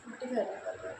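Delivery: ordinary talk, nothing marked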